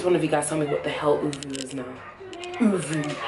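ordinary talk

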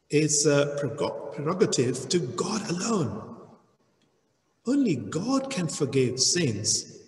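usual speaking voice

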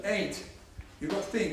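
usual speaking voice

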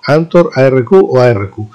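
ordinary delivery

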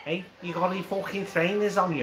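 mock Scouse patter; Liverpool accent. Shown as a demonstration. Mate answering the dare, imitated Scouse accent. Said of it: "that's it, he's got it".

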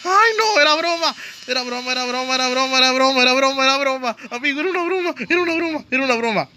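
high, frantic pleading squeal; panicked pleading. Real, not acted. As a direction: beg in panic. Begs monster, was just joking.

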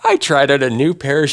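normal talking voice

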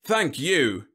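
high, loud, gratified thanks; glowing. A narrator's reading. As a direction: glow with pleasure at the praise. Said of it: "General Webb glowed".

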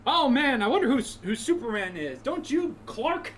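high-pitched, hammed-up naive wondering; mock cluelessness. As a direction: play oblivious in a high, exaggerated voice. Mock-clueless friend of Clark Kent.

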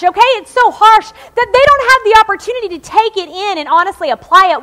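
ordinary talk, nothing marked

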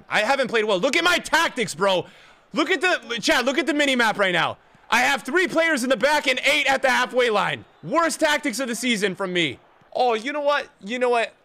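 high, loud self-criticism; exasperated rant. Real, not acted. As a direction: rant loudly at your own tactics. Ranting about tactics to chat.

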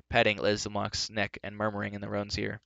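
usual speaking voice

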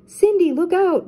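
high, loud warning cry; urgent call. Acted. Velma warns Cindy the monster is behind her, loud and high. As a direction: shout the warning across the snow. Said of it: "Velma called".

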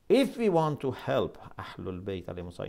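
ordinary delivery

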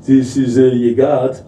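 low, droning tannoy voice; imitating a guard's deadpan commentary over the speaker; mock train announcement, pitched well down. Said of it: "on the voice, Sonny goes".

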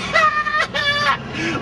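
high giggling laugh; a squealing laugh; gleeful laugh. Real, not acted. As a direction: crack up with glee. Laughing at taunt.

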